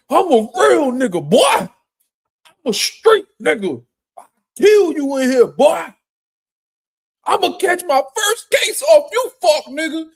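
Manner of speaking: loud mocking gangster bravado; mock tough-guy threats. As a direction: parody a fake hard guy puffing up. Mocking tough guys after a bump.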